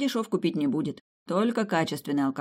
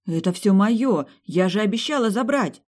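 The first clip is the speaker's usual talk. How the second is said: возмущённо; 6 semitones higher, 6 dB louder than usual. A narrator's reading. Продолжение спора с матерью.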